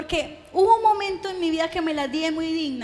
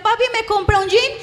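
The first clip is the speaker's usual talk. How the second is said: put-on higher, louder childlike request; pleading child; 5 semitones higher, 6 dB louder than usual. Daughter begging her father for jeans, childlike plea. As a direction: ask like a girl begging her dad.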